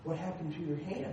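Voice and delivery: low, hushed deadpan; small, soft voice of a shocked kid; stunned question. Retold or imitated. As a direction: ask it quietly, aghast. Boy stunned by swollen hand.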